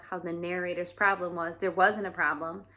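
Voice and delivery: ordinary delivery, nothing marked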